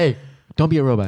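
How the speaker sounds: mock directing. Re-enacts his direction from the footage.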